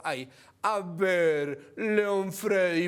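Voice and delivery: very slow, drawn-out exasperation; exasperated appeal. Pretend. Host addresses the wayward ambassador, slow mock-patient exasperation. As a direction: stretch each word like a weary scolding.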